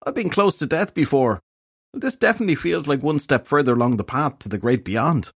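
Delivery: high, melodramatic complaint; hungover fairy detective, self-pitying. A narrator's reading. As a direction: play the suffering patient near death.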